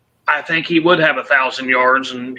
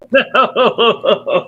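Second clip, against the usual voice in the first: stifled laugh on the word; trying not to laugh; 6 semitones higher than usual. Real, not acted. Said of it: "I'm trying not to laugh".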